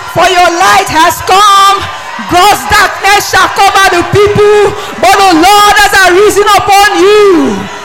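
loud, rising, proclaiming shouts; fervent preaching. Real, not acted. Pastor proclaiming to the congregation, loud and fervent. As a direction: preach it out over the crowd.

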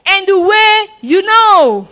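forceful scripture reading. Real, not acted. Preacher reading Jesus' and Thomas's words to the congregation, forceful. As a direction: ring the verse out to the congregation.

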